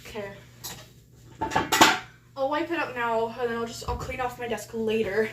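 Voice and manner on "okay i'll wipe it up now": usual speaking voice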